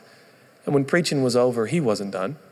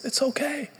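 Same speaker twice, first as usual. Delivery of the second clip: high, soft reassurance; reassuring; 14 semitones higher, 5 dB quieter than usual. Retold or imitated. Father in great pain reassuring his son, soft and high. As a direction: brush off the pain gently.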